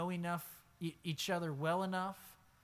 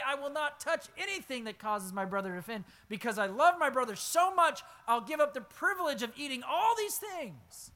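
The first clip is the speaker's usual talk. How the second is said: fervent vow; 6 semitones higher, 5 dB louder than usual. Acted. Continues Paul's vow fervently.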